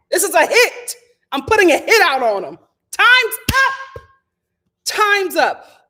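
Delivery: loud, high threat; hammed-up mob boss; fired-up declaration. Real, not acted. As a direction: announce the hit with gusto. Declaring war on bugs.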